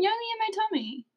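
high, soft cartoon quote; imitating Broby. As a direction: mimic a kids' show character up high. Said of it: "he's saying".

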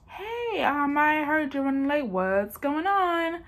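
a hint of a concerned doctor checking in; doctor on the phone. Doctor calling the late patient, mild concern. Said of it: "my doctor calls me".

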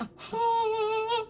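high-pitched retold scream, held back in volume; drawn-out high "ah" of mock terror. Girl spots the inflatable Grinch, high mock scream. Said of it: "I scream even louder".

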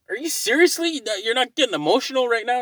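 can't believe she isn't crying; incredulous. Retold or imitated. Retold quarrel with wife on couch.

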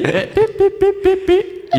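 very high little "pi" blips; high-pitched cartoon work noises; imitated dabbing noises. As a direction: squeak out rapid patching sound effects. Mimes slapping plaster on the bodge.

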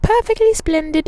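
put-on high British accent, prim; a posh British child; British little girl. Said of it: "there was a little girl, and she was, like".

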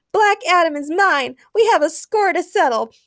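high, loud vengeful cry; shouting. A narrator's reading. Supergirl carries off the wrong man, shouting. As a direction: shout your claim on the foe. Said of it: "while shouting".

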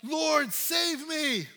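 slow, high, loud cry for help; drawn-out plea, shouted from the waves. Acted. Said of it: "He cried out".

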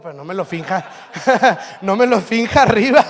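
laughing loudly through the words. Real, not acted. Pastor laughing while telling him not to fake it. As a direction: crack up while saying it.

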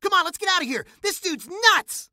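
scared yell to run away; panicked shout. Acted. Fleeing the hammer-wielding kid.

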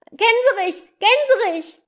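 high, loud anxious call; boy shouting up to the goose. Acted. Boy calls up to the flying gander, loud and high. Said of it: "rief der Junge".

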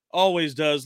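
ordinary delivery

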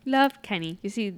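ordinary talk

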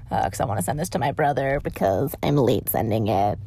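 ordinary talk

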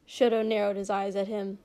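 usual speaking voice

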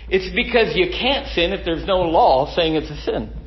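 usual speaking voice

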